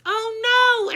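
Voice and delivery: high, loud dismayed cry; yelled. A narrator's reading. Everybody crying out as the mirror breaks, high and loud. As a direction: shriek in dismay. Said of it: "everybody yelled".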